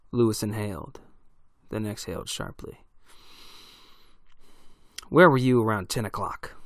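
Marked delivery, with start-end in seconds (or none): 5.1-6.5 loud barked demand; barked question. A narrator's reading. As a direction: bark it at him like an order. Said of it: "Lewis barked at Alvin".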